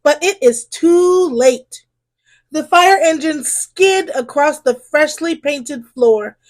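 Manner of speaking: usual speaking voice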